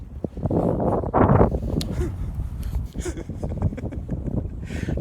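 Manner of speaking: high, airless mocking laugh; breathy taunting laugh. Real, not acted. Preacher laughing at the taunted kid, breathy and high. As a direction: laugh at them under the breath.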